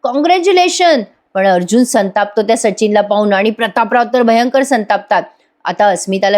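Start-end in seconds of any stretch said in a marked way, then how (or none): 0.0-1.0 a higher, happy retold congratulation; mildly excited, as if cheering her brother. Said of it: "खुश होऊन म्हणते". (आनंदाने अभिनंदन करत)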